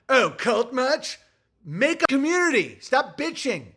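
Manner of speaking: sarcastic sneer. Retold or imitated. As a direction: drag it out like a smug critic. Mocking critics' sneer.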